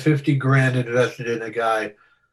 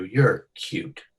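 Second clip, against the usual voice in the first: ordinary talk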